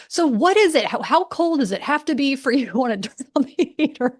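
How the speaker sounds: retold outburst at a partner; going off. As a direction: fire off exasperated questions, pitch jumping up. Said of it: "And I start going off".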